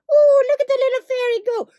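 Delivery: high, fast, sing-song squeal; mock the idea as a silly fairy tale. Mocking spiritual folk who picture fairies, high squeal.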